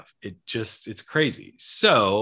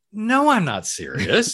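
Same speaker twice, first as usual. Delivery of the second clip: slow, higher sarcastic denial; 9 semitones higher, slower than usual. Retold or imitated. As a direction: drag it out, deadpan sarcasm. Sarcastic reply to friend.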